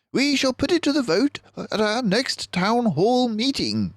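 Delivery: high, brisk decisive voice; a leader settling the matter; Basil the mouse detective. Acted. Basil answers his friend, high and decisive.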